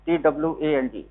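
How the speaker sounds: T W A N G spelled letter by letter for the class; dictating the spelling of twang. Shown as a demonstration. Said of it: "that is called nasal twang".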